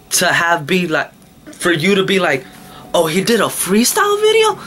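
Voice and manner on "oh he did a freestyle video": high, incredulous mimicry of a viewer; mock them with a jump up in pitch. Mocking what unsubscribers say.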